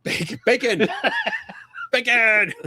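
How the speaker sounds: crowing the name while laughing; playful mock cheer; gleeful shouting. Real, not acted. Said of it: "Aaron Bacon".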